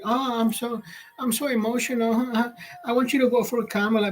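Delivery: high, soft, weepy put-on plea; mock-emotional J-Lo. Streamer mocking a celebrity's tearful endorsement, mock-emotional. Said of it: "Look at J-Lo. She's, she's like".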